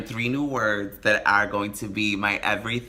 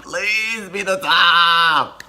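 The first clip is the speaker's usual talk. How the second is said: high, slow, loud put-on wail of a plea; mock desperate plea; 7 semitones higher, 6 dB louder, much slower than usual. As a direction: beg dramatically in a hammed-up high voice. Begging friend, voice breaks high.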